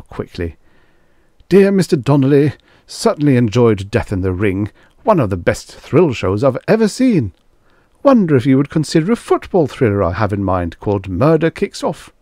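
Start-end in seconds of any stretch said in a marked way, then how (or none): 1.5-12.1 raised, eager letter-writer voice; lightly voiced fan. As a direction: read it like a gushing fan.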